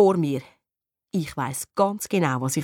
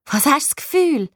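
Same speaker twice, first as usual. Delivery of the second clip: high, venomous comeback; prickly, raised retort; snide retort; 7 semitones higher than usual. A narrator's reading. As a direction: shoot back a spiteful question. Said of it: "giftet sie zurück".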